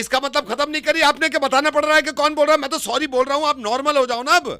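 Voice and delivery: loud, high-pitched whining argument; exasperated protest. Pretend. Caller refusing to say who is speaking, exasperated protest. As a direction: push back at the top of the voice.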